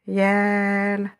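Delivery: YAL with the vowel held long and level; Yal, drawn out as a reading demo. Shown as a demonstration. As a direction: stretch the "a" in Yal. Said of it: "E long".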